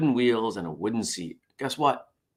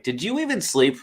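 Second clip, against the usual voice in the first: higher, lightly fussing gadget voice; voicing the smart ring; 8 semitones higher than usual. Vlogger voicing his smart ring, lightly fussing. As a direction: play the ring as a worried nag. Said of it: "it basically said to me".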